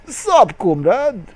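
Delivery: high, loud casual Russian greeting; Russian block voice. A narrator's reading. Reader voices the L block greeting its comrade in Russian accent. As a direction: toss it off like a Russian pal.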